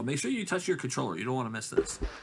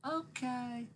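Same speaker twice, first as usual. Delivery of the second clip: put-on high, soft little-kid reply; young kid voice; 12 semitones higher, 7 dB quieter than usual. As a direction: answer softly up high, imitating a child. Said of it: "he sounded young at first".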